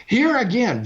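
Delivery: usual speaking voice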